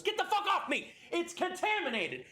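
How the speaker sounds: high, loud panicked yell; frantic shout at the crowd; furious shout. Acted. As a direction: scream at them to let go. NPC lashes out at helpers.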